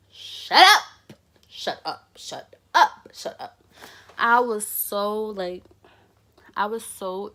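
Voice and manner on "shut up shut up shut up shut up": sharp shush. Retold or imitated. Telling the friend to stop talking to the officer, with a loud sharp snap.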